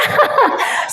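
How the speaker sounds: short breathy laugh mid-story; amused laugh before the punchline. Real, not acted. Laughs while retelling testimony. (tertawa kecil di tengah cerita)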